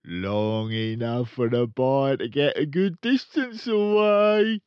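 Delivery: loud, high, sly grin; grinning. Acted. Father answering Matilda, sly grinning gloat. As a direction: say it with a crafty grin. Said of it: "the father said, grinning".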